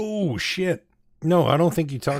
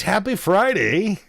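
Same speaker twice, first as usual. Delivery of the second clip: cheery stretched greeting; 8 semitones higher, 4 dB louder, slower than usual. Acted.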